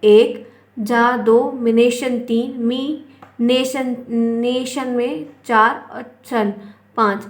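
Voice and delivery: slow count of examination syllable by syllable; teacher slowly counting out the syllables. Shown as a demonstration. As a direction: pull each syllable apart as you count it. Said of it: "आप देखो एक".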